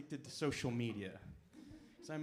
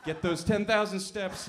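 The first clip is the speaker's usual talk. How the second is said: mock cheer; 5 semitones higher, 10 dB louder than usual. Punchline cheer.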